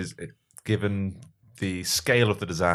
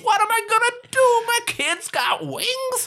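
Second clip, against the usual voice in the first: much higher, silly panicked guy; squeaky cartoon man panicking; high comic character voice; slower than usual.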